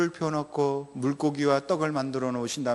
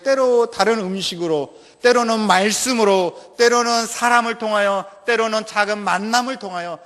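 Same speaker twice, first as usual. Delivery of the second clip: preaching; 5 semitones higher, 4 dB louder than usual. Acted. Preaching at full force.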